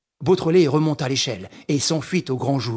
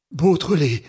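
understated, horror-struck stammer; a touch of terror on a falling call; frightened stammer; 3 semitones higher, 4 dB louder than usual. Acted. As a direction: falter the name in mild dread. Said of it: "balbutia monsieur Filleul".

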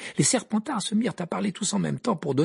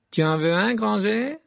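louder taunt rising high at the end; taunting offer; 7 semitones higher, 4 dB louder than usual. A narrator's reading. Malfoy offers Hermione a badge, taunting. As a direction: needle her, lifting the pitch on the name.

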